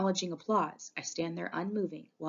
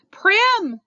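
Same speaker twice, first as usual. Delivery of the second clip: high, loud desperate call; strangled cry; 14 semitones higher, 13 dB louder than usual. A narrator's reading. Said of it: "The strangled cry comes out".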